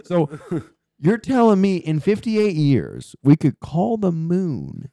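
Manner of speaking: ordinary talk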